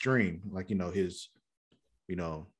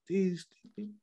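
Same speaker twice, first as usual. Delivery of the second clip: high-pitched, softer stammer of disbelief; mock exasperation; 11 semitones higher, 4 dB quieter than usual. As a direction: voice their frustration in a high squeak. Retelling Joe and Cecile venting.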